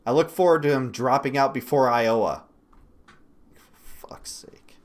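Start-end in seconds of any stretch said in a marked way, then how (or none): 3.6-4.8 breathy whispered curse under the breath; exasperated whisper. Real, not acted. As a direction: mutter it to yourself, worn out. Exasperated aside after the rant.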